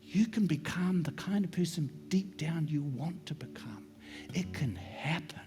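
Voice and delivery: slow, near-whispered promise; soft, unhurried whisper to the congregation; hushed preaching. Real, not acted. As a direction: let each phrase sink in, very softly.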